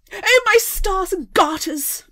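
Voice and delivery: high, loud, slow mock Victorian gasp; hammed-up shocked gentlewoman; scandalised lady. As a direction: clutch your pearls, outraged.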